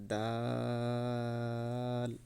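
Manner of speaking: জিম held very long, three alif counts; long jim. Shown as a demonstration. Teacher modelling a three-count jim, drawn out. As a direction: stretch the vowel of জিম long and even. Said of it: "তিন আলিফ টান হবে".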